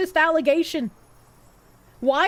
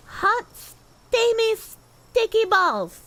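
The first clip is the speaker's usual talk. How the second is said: drawn-out name; 7 semitones higher, much slower than usual. Acted. Reading a silly donor name.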